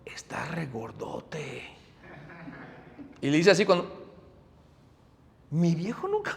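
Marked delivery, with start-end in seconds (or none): 0.1-1.6 whispered, slow and much softer; grandfather's soft whisper to his grandson; whisper in the ear. Retold or imitated. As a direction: lean in and breathe it into his ear. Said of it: "Se me acerca al oído".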